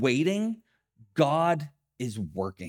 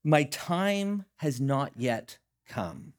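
slow solemn reply; slower than usual. Retold or imitated. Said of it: "He replies".

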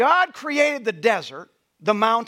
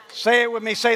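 ordinary delivery

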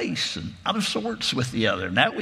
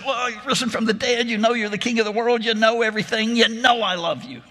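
higher pained insistence; pained plea; 9 semitones higher than usual. A narrator's reading. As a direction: plead with him, pitch up. Peter pained, pressed a third time.

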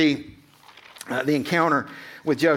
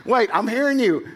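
startled Mary; 9 semitones higher than usual. Retold or imitated. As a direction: play Mary stopping the angel mid-sentence, startled and high. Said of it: "she's like".

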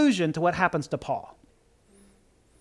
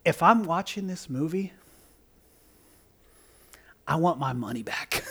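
ordinary talk

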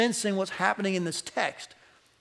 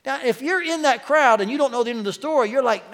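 usual speaking voice

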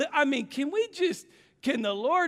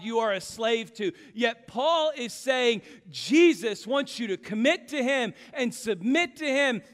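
building, louder sermon cadence, slowing at the end; preaching; 5 semitones higher, 5 dB louder, slower than usual. Acted. Pastor pressing the congregation about false gods, preaching hard. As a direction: drive the point home from the pulpit. Preaching climax on slavery and freedom.

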